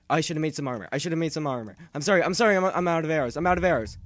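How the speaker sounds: high, loud, rapid pleading; panicked yelling. Real, not acted. Player out of arrows under attack, panicked yelling. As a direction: yell it in a frantic repeated rush. Panicked apologies during a fight.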